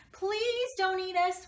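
high, slow scared begging; terrified dogs pleading with a monster; frightened plea. Acted. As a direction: beg the monster for mercy. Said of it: "cried the dogs".

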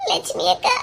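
breathless whisper of a frightened woman; barely voicing the name in dread. Woman whispering to Selva in fear at the hospital.

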